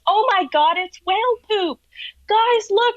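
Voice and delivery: high-pitched mock excitement; excited airhead naturalist. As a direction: imitate a gushing, clueless tour guide. Acting an airhead naturalist pointing out poop.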